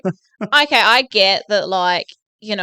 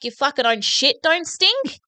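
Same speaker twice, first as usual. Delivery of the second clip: ordinary talk, nothing marked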